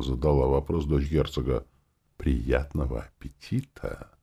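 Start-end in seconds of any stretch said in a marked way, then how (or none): 2.2-4.1 slow hushed sinister wish; ominous whisper. Acted. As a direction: whisper it with mock menace. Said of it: "Зловещим шёпотом пожелала голова брата".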